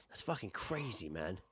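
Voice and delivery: usual speaking voice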